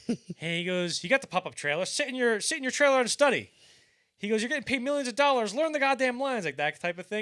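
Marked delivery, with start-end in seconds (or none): 1.0-3.5 high, loud mock scolding; imitated comic tirade; Don Rickles. Voices Rickles berating an actor who stuttered. 4.4-6.5 loud, high exasperated mock rant; hammed-up comic scolding; Don Rickles. Voices Rickles berating an actor who stuttered.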